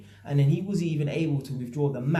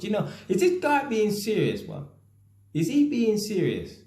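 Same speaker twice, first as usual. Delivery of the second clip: disbelieving, pitched up high and louder; incredulous; 8 semitones higher, 4 dB louder than usual. Retold or imitated. To himself about the debtor's excuse, high incredulous.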